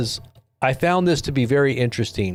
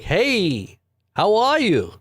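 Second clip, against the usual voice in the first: put-on Canadian accent; 7 semitones higher, much slower than usual. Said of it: "they got that, uh, Canadian accent".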